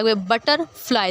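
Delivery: "Butterfly" slowly, parting बटर from fly; split into syllables. Shown as a demonstration. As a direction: draw "butterfly" out in parts. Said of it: "king और एक तरफ हो गया". ("Butterfly" धीरे, हिस्सों में)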